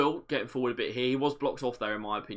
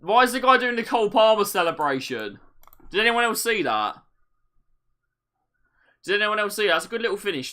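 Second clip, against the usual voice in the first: surprised; 8 semitones higher, 8 dB louder than usual. Real, not acted. Amazed at celebration.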